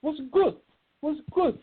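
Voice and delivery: high-pitched put-on voice; high, squeaky-register caller greeting a friend; forty-five King. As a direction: mimic him way up high in pitch. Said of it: "he calls me".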